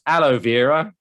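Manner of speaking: "Aloe Vera" in an English accent, like "hello Vera"; English accent, "Aloe Vera" heard as a greeting. Shown as a demonstration. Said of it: "in my English accent".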